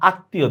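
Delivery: আত্মীয় as "attiyo"; m not pronounced. Shown as a demonstration. Teacher shows doubling, আত্মীয় without its m. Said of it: "ময়ের উচ্চারণ হচ্ছে না".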